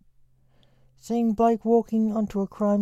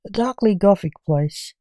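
loud, showy host intro; podcast announcer; 9 dB louder than usual. Acted.

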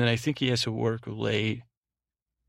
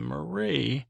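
mock-scandalized, higher-pitched drawn-out name; retold reproach; 4 semitones higher than usual. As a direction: imitate a scandalized reaction, pitch swooping up. Retold reaction to Marie's sex question.

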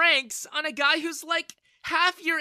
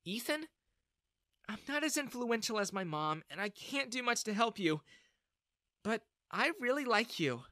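shy confession; 7 semitones higher than usual. A narrator's reading. Bella shyly confesses her feelings.

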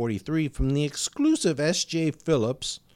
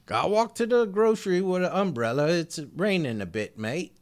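bad Australian accent. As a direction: ham up a terrible Australian accent, mate. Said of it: "the worst Australian accent I've ever heard".

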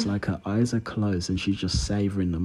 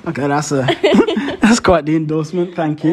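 laughing, higher and louder; 7 semitones higher, 6 dB louder than usual. Real, not acted. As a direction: laugh it off, amused. Laughing reaction to praise.

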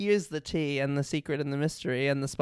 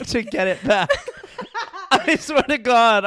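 laughing; 5 semitones higher, 3 dB louder than usual. Real, not acted. Laughing about going bald.

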